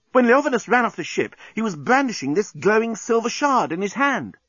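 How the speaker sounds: high-pitched, eager explaining; excited exclamation. A narrator's reading. Journalist piecing it together aloud, excited. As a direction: blurt out the realisation high. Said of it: "he exclaimed".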